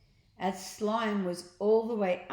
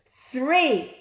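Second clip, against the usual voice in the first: louder and higher on three; building count; 7 semitones higher, 11 dB louder than usual. A narrator's reading. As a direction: ramp up into the shout. Counting up to a shout.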